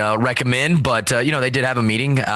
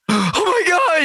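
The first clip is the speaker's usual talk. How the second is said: much higher, mock panicked girlfriend; imitating panicked girlfriend. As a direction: squeal it like she's freaking out. Girlfriend voiced freaking out.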